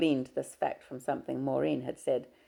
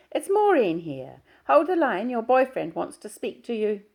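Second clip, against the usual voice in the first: bright, raised, louder chatty greeting; a friendly colleague calling up, higher and louder; Maureen's voice; 8 semitones higher, 7 dB louder than usual. Acted. Maureen greets Diana on the phone, higher and louder.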